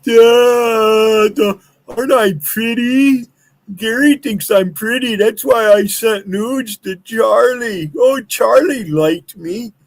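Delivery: high, slow, simpering mock-girl voice; mocking girly voice. Mocking a woman's voice.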